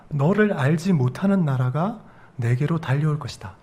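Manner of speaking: ordinary delivery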